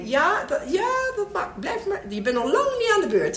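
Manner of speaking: exaggerated high stalling; role-played queue boss. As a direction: fob them off in a squeaky-high pretend voice. Child role-plays keeping mother waiting.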